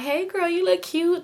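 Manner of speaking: high, slow sing-song flattery; mock-sweet imitation. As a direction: imitate a fake-friendly girl buttering someone up. Mimics her friendly follow-back message.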